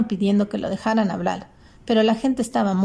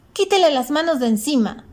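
high, forceful command; thundering protest; 7 semitones higher, 3 dB louder than usual. A narrator's reading. Girl defending her friend from the driver, forceful. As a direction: cut in with a raised, higher voice. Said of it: "tronó fuerte la voz".

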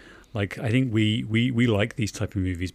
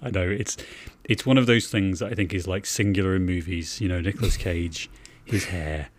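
usual speaking voice

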